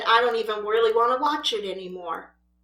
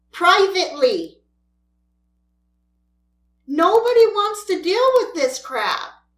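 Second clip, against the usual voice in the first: louder and higher, lecturing; stern scolding; 4 semitones higher, 3 dB louder than usual. Real, not acted. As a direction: tell them off firmly. Scolding feuding creators.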